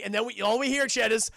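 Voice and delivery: ordinary talk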